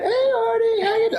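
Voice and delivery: very high, squeaky mimicry of a caller; Jennifer's high pitch. Friend phoning Artie, imitated in a very high pitch. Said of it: "it's just this high pitch".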